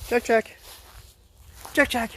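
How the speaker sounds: high sing-song call of a name; calling the dog. Real, not acted.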